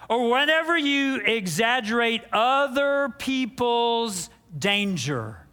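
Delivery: ordinary talk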